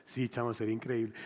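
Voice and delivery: lazy buddy. Retold or imitated. Voicing a buddy's reply.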